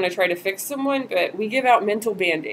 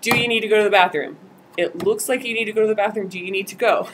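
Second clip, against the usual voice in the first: fast, louder pushy questions; closed questions; 4 dB louder, faster than usual. Shown as a demonstration. Volunteer quickly asks participant about the bathroom, fast and pushy. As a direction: rattle off the yes-no questions. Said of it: "use closed questions".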